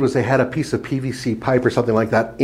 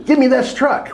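pitched-up imitation of people screaming; mock screaming demand; 11 semitones higher than usual. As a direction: imitate clamoring fans with a high, mild yell. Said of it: "just sc-, screaming".

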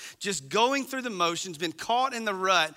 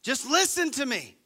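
louder scolding parent; exasperated parent; 5 semitones higher, 3 dB louder than usual. Retold or imitated. Parent fed up with a sarcastic kid, scolding. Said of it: "wanna smack 'em and say".

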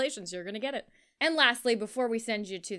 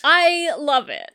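emphatic declaration; 4 semitones higher, 13 dB louder, slower than usual. Real, not acted. Admitting love for trash movie.

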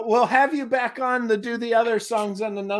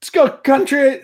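excited call; 6 semitones higher, 4 dB louder than usual. Real, not acted. Host calling out country choice.

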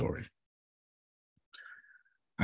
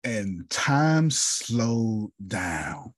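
very slow, higher, drawn out; slowed right down; 5 semitones higher, much slower than usual. Real, not acted. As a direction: let each word hang as time stops. Dramatic moment in his testimony.

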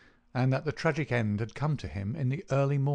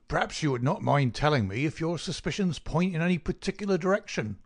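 higher-pitched, probing doctor's voice; doctor questioning Holmes; 5 semitones higher than usual. A narrator's reading. The doctor asks Holmes about his suspicions, probing. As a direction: press the question in a raised voice.

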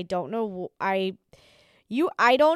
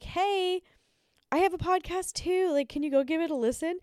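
high, bright asking voice; mock eager request; eager fan voice; 8 semitones higher than usual. Imitating DMs from podcasters.

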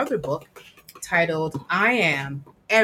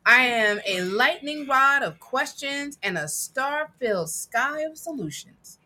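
put-on reading to children; 6 semitones higher than usual. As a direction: read it proudly to a child. Reads picture book aloud.

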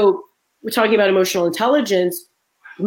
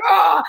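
quick mock scream of alarm; mock stifled scream; 7 semitones higher, 3 dB louder than usual. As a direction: let out a short alarmed cry. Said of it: "I was like".

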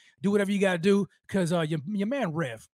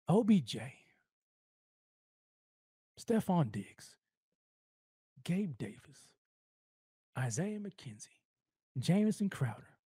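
awed whisper; 5 semitones lower, 8 dB quieter, slower than usual. Acted. Savouring thought of new receiver.

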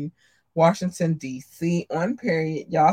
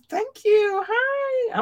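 squeaky cute voice; much slower than usual. Acted. Cutesy thanks to listeners.

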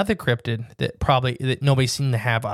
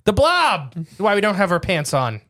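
mock panic; 6 semitones higher, 4 dB louder than usual. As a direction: whine out a fake excuse in a panic. Mock panic of caught men.

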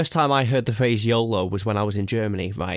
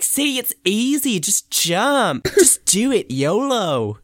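higher, slow smug encouragement; mock German boy accent; 9 semitones higher, 4 dB louder, much slower than usual. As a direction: show off after the jump, higher. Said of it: "I was like".

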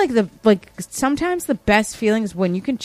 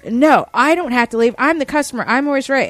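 mock angry protest, higher and louder; yelling customer; 4 semitones higher, 3 dB louder than usual. Customer refusing to leave the store, mock yelling. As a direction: imitate an entitled shopper yelling back. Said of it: "she started yelling at me".

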